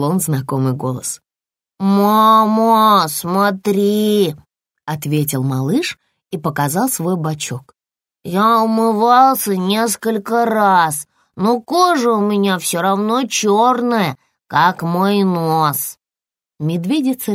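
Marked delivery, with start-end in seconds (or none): 1.8-4.3 put-on drawn-out, louder child's call. Bear cub shows mother his side, calling out loudly. 8.2-16.0 put-on drawn-out childlike cub voice, pitched up; bear cub, troubled.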